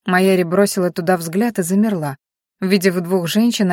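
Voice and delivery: ordinary delivery, nothing marked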